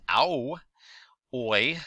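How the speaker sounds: ow and oi sounded out clearly on their own; vowel demo. Shown as a demonstration. Teacher sounding out vowel sounds for assonance, clear and drawn. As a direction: say ow, then oi, stretching each vowel. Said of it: "It could be the ow sound".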